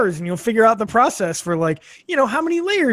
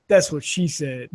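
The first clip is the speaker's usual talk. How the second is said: low, slow, knowing delivery; deadpan punchline; 5 semitones lower, 4 dB quieter, slower than usual. Retold or imitated. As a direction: drop it low and slow with a wink. [name] winks and delivers punchline.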